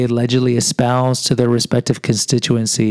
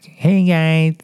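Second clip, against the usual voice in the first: Cartman impression; 3 semitones higher, slower than usual. Shown as a demonstration. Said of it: "I sound like Cartman from South Park".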